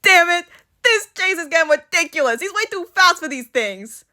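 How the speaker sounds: high-pitched fed-up villain rant; frustrated outburst. A narrator's reading. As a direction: burst out in acted frustration. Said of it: "observes with growing frustration".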